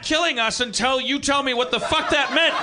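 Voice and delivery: angry shout. Acted. Hitler screams at his wife in the bunker, furious. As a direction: yell at her, pitch way up.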